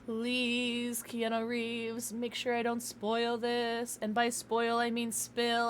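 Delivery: soft, level, monotone chant; mock prayer. Streamer begging Keanu Reeves for a steady pour, mock prayer chant. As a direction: intone it slowly like a prayer.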